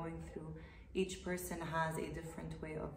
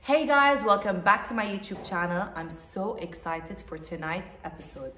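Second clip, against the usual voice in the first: YouTube host intro; 11 dB louder than usual. Acted. Host opening a YouTube video for viewers, presenter greeting. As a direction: greet the viewers at full volume, pitched up at the start.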